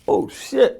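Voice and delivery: imitating his own shock; mock startled curse; retold alarm. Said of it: "I said".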